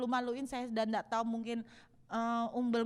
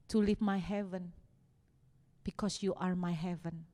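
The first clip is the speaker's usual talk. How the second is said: tender voice of God; 3 semitones lower than usual. Acted. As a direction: speak as a heartbroken loving father. God speaking tenderly, English mix.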